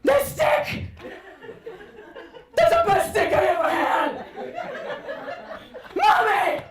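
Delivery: mother imitating her son's meltdown over a stick; wailing little boy. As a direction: sob out the loss of the best stick ever. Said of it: "he's just like".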